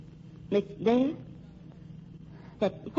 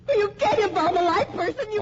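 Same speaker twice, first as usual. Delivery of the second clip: high, loud, crying in terror; terrified plea; 10 semitones higher, 4 dB louder than usual. Acted. Betty pleads with Renfron holding the needle, terrified crying. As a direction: beg him, near tears.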